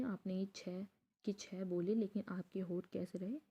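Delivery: ordinary talk, nothing marked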